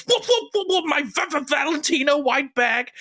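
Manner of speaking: very high stuttering mimicry; pitched-way-up, stuttered meme voice; vine lady impression. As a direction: imitate her squeaky stammered outrage. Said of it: "and she goes".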